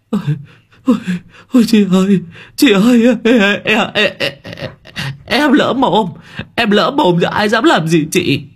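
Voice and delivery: high frightened stammering plea; panicked begging. Acted. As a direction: beg for mercy in a high squeal. Thành begging after slap.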